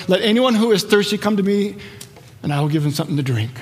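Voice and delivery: normal talking voice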